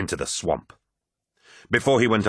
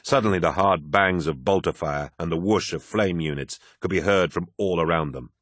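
ordinary delivery, nothing marked

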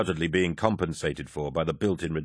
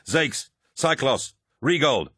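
higher, slow calling of names; yelled roll call; 6 semitones higher, slower than usual. A narrator's reading. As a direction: yell out each name as an order. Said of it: "Torgadden yelled".

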